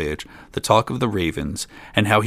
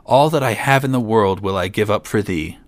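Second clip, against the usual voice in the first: ordinary delivery, nothing marked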